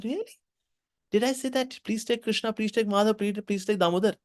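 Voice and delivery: high, protesting fruit seller; mock indignant. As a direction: act out her indignation. Fruit seller protests mocking retelling.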